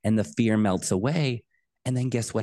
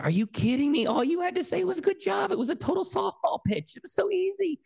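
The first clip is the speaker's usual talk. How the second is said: very high, squeaky incredulous protest; hurt kid's exasperated inner wail; pained disbelief. Retold or imitated. Child reeling from dad's cold answer, pained disbelief. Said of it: "that pain of, like".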